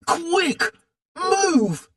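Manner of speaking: high urgent shout; a boy shouting a warning. A narrator's reading. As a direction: yell it to get them moving. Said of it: "shouted D J".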